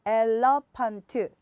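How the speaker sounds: 엘러펀트 slowly in four equal Korean syllables; four-syllable split. Shown as a demonstration. Mom teaching English by rote, 엘러펀트 in four syllables. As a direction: spell out 엘-러-펀-트 beat by beat. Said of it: "네 개의 음절의 소리예요".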